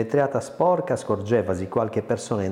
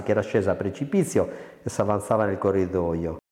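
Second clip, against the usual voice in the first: plain reading voice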